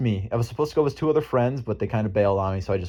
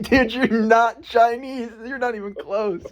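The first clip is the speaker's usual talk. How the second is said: high, slow mocking voice, higher throughout; mock retort; 10 semitones higher, 3 dB louder, slower than usual. Teasing a friend who claims to be Chinese, high and slow. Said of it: "it's like".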